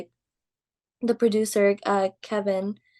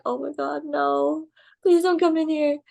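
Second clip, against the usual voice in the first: mildly panicked plea, pitched higher; retold freak-out; 6 semitones higher than usual. Trainee hearing the boss coming, lightly acted panic. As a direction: act out dreading who is coming. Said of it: "I was freaking out".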